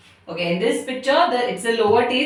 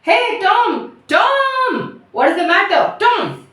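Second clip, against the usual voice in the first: high, urgent cries to a brother; worried calling; 11 semitones higher, 4 dB louder, slower than usual. Acted. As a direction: call out in alarm, stretching the name. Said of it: "now worried".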